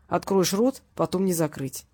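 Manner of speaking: ordinary delivery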